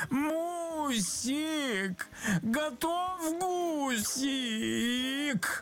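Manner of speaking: high, long-drawn wailing call; wailing yell. A narrator's reading. Hungry engineer who pictured a roast goose, wailing. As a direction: stretch it out in a high wail. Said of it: "завопил".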